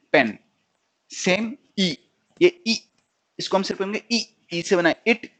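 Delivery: ordinary talk, nothing marked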